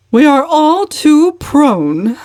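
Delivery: high, loud, slow pious sermonizing; sanctimonious preaching. Acted. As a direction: lecture the table like a preacher, slowly. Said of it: "determined not to lose so good an opportunity".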